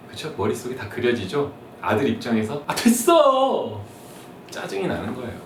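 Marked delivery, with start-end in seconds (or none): 2.7-3.8 high, slow dragged groan; irritated son. Acted. Son brushing off his mother, high dragged snap. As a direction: drawl it out like a fed-up teen. Son's reply in skit.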